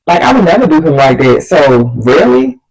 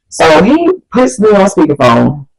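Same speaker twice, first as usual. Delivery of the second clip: ordinary delivery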